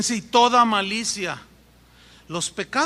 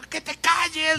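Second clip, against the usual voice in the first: high-pitched, exasperated mock yell; imitated angry insistence; husband's angry order; 8 semitones higher, slower than usual. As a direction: act out a husband silencing his wife. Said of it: "le dice".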